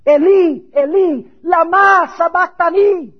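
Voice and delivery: loud, high shout of anguish; anguished cry. Acted. Preacher voicing Jesus on the cross, anguished shout. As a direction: cry it out as if forsaken. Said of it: "You can hear the anguish in the voice".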